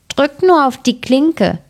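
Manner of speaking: put-on high, slow old-lady call; grandmother calling out. A narrator's reading. Grandmother calls to the visitor, high and slow. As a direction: call slowly from the bed, pitched high. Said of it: "rief die Großmutter".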